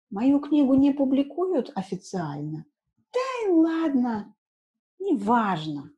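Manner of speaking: lightly voiced writer who doesn't care; author's voice. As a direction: shrug it off as the author. Teacher voices the author Erofeev.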